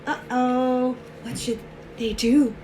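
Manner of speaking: usual speaking voice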